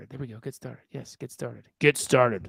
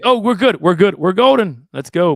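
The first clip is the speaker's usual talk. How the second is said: loud, happy relief; excited; 2 semitones higher, 10 dB louder than usual. Real, not acted. To Andy after Excel finally loads, loud cheer.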